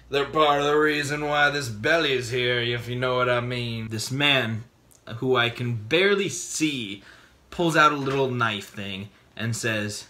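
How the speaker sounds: usual speaking voice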